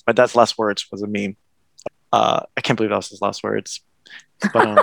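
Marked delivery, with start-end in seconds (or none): none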